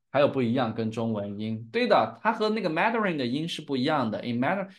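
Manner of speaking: ordinary talk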